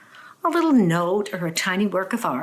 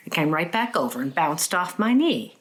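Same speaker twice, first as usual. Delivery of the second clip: normal talking voice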